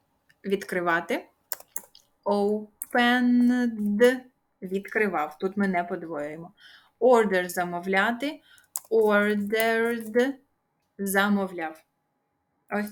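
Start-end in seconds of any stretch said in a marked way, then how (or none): 2.2-4.2 "opened" stretched very long, sound by sound; slow dictation. Shown as a demonstration. Teacher spelling out the past form, very slow dictation. 8.9-10.3 "ordered" stretched very long, sound by sound; slow dictation. Shown as a demonstration. Teacher spelling out the past form, very slow dictation.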